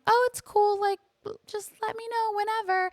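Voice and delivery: very high, breezy it's cool; overly sweet. Retold or imitated. Mother to the absent principal, high and sugary. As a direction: chirp it high and sugary, laid back. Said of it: "And I'm like".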